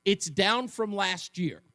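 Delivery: slow, deliberate restatement; cross examination. Real, not acted. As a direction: lay it out slowly. Host restates slowly.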